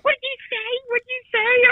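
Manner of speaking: very high, squeaky mock challenge; imitated indignant woman. Bitching travel partner runs up at him, high mock indignation.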